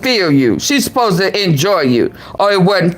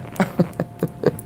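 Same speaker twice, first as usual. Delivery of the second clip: soft unvoiced stunned laugh; a breathy laugh of disbelief; breathless laugh; 4 semitones lower, 10 dB quieter than usual. Real, not acted. Stunned reaction to the clip.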